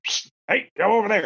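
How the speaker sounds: high mock whisper-call from the tractor; a hint of dad calling across a field; imitating dad. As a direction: call out to the kids like dad did. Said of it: "he'd be like".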